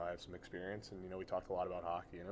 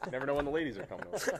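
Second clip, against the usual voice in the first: laughing; 7 semitones higher, 6 dB louder, faster than usual. Real, not acted. Joking about ladies visiting.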